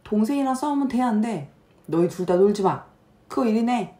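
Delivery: put-on high, loud scolding of the kids; angry scolding mother. Mother yelling at her fighting kids, high and loud. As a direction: scold them like an exasperated mom.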